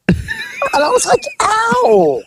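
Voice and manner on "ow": high drawn-out "ow"; mock pain cry. Customer whose feet got slapped, mock pained yelp. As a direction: yelp it high, hamming up the sting.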